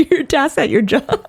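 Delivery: amused, laughter breaking into the words; laughing. Real, not acted. Correcting own slip to a friend, laughing. Cracking up at own misspoken phrase.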